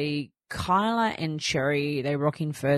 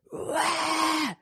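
much higher imitated baby wail; baby cry imitation; 13 semitones higher than usual. Mum imitating baby Rocco screaming, high wail.

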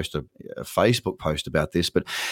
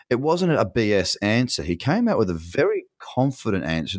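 normal talking voice

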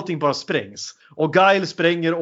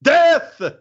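high, loud battle cry; mock epic war cry; 11 semitones higher, 4 dB louder, much slower than usual. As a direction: roar it like a king before a charge. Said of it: "fast i Lord of the Rings".

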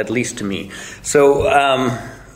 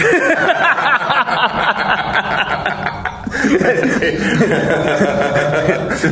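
long laugh; 10 semitones higher than usual. Real, not acted. Laughing at own story.

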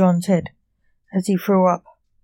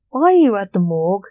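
louder, mildly curious question; 7 dB louder than usual. A narrator's reading. Blake questions John at the morgue, mildly curious. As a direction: lift the opening, wanting to know. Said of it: "Blake asked curiously".

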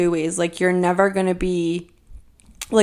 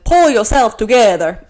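loud, hammed-up cartoon accent impression; Edna; 3 semitones higher, 9 dB louder than usual. Quoting a cartoon character's scolding line, terrible loud accent. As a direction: belt out a bad character accent. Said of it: "that was a terrible Edna accent".

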